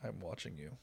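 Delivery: low, very soft watchful murmur; sleepy cat's warning. Acted. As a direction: voice a drowsy cat keeping watch, low and hushed. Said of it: "so she's like".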